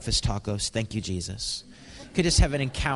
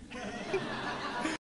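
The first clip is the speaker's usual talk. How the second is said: a high, breathy burst of laughter; 10 dB quieter than usual. Real, not acted. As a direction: laugh out at the punchline. Laughing at friend's text.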